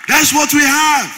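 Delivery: loud, high, leaning on we; shouted again. Real, not acted. Pastor repeating the refrain in a shout. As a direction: shout it back at them.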